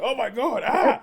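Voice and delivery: high, laughing yelp of alarm; mock panic. Recalling grabbing the sippy cup, a high mock-panicked yelp. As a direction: play the startled dad, squealing with a laugh. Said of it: "I was just kind of like".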